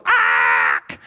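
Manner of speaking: loud drawn-out "aaack"; acted yelp of alarm; strained cry. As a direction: cry out as you let go. Peter lets go of the boat.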